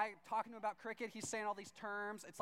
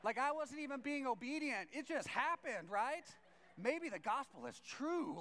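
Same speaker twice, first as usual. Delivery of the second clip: raised voice, mild mock amazement; amazed; 7 semitones higher, 4 dB louder than usual. Pastor marvels that the gospel may be true, amazed. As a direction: retell a stunned revelation, drawing out the key words. Amazed at neighbor's dinner invitation.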